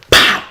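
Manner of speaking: loud mock smack noise; paddle pop sound. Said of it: "So my dad's like".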